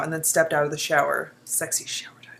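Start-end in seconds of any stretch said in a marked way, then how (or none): 1.4-2.3 breathy unvoiced whisper; whispered aside. Real, not acted. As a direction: drop to a secret whisper. Aside whispered during reading.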